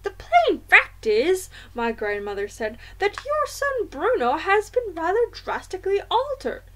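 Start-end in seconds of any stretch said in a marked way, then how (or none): 0.0-1.5 high-pitched, louder grandmother voice; indignant grandmother. A narrator's reading. 3.0-6.6 indignant grandmother. A narrator's reading.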